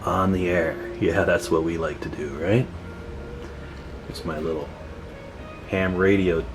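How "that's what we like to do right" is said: ordinary talk, nothing marked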